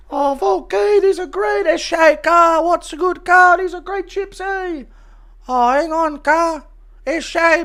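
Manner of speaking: very high put-on voice, loud then fading; hammed-up mock heart attack in a high voice. Guest on cooking show clutching his chest, high comic impression. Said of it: "Impression of Ty on Cooking with Volk".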